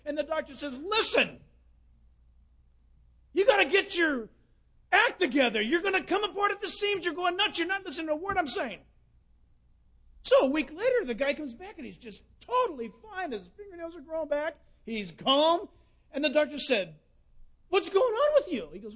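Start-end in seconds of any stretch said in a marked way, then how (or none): 0.8-8.8 raised, higher voice, scolding hard; doctor shouting at his anxious patient to get it together. Acted. 17.7-18.7 high, loud, amazed question; doctor amazed at his calm patient; surprised doctor. Acted. Doctor asks cured patient.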